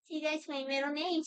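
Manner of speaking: high, put-on dog voice speaking for the pet; voicing the dog. Owner speaks for his dog in a high silly voice.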